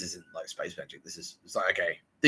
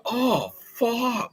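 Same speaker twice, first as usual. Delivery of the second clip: scared oh fuck. Retold or imitated. Man scared.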